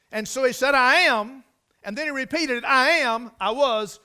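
high, loud, driving preacher's delivery; impassioned preaching. Acted. Preacher quoting God's words to Moses, impassioned preaching. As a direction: hammer out the repeated phrases to a congregation.

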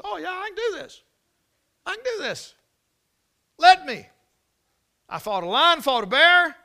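put-on a teenage boy begging to be let fight; eager insistence. David insists he can fight.

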